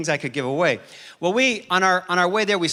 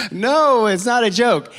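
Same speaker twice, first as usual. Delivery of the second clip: raised, higher voice pleading; urgent raised protest at the guest house door; loud insisting; 6 semitones higher, 7 dB louder than usual. Retold or imitated. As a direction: insist loudly into the intercom. Insisting over intercom.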